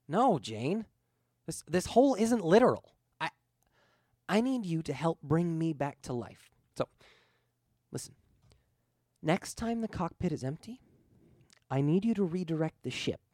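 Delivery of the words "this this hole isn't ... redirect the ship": higher, urgent ghostly plea; pleading ghost. Acted. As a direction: press him gently but urgently. Ghost explains its request.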